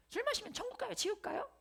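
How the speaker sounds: put-on high-pitched kid asking; middle schooler. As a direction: play a curious young student. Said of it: "몇 명 애들이 꼭 질문해요".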